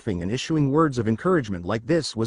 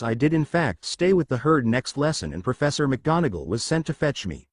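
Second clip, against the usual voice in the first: ordinary delivery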